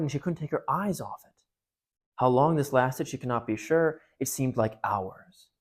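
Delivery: steady storytelling voice, giving each speaker a light voice; reading a novel passage aloud to the congregation; story read aloud. Acted. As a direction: read it to the listeners like a well-loved book. Reads the Narnia passage aloud.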